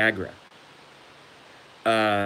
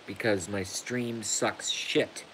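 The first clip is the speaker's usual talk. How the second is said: muttered gripe; 8 dB quieter, slower than usual. Real, not acted. Grumbling at his stream.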